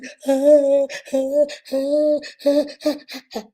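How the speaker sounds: long, high mock wailing; imitated ugly Nigerian cry. Shown as a demonstration. Showing listeners how our own people cry, loud mock wailing. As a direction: fake a big unrestrained bawl. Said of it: "Our own is".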